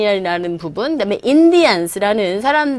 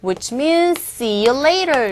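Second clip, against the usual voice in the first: slow key phrase; 2 semitones higher, much slower than usual. Shown as a demonstration. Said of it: "오늘의 key expression".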